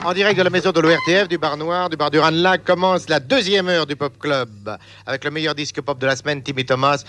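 fast, high-pitched live announcer; radio presenter. Real, not acted. Presenter to listeners as the second hour starts, brisk announcer delivery.

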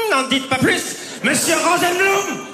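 high, slow sly waiter voice; knowing waiter. Retold or imitated. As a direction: drawl it like a sly barman.

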